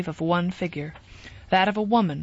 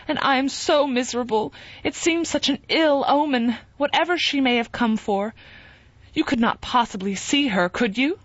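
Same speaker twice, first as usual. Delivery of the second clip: higher, fretful plea; miserable pleading Sue; 7 semitones higher than usual. A narrator's reading. Wife pleading with husband, mildly miserable and higher. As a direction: beg him not to see her.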